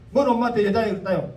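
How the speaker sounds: mock an ignorant critic's outrage; indignant Jeolla scold. An ignorant critic mocking Jesus's words, in loud, indignant Jeolla dialect. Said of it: "그 무식한 소리야".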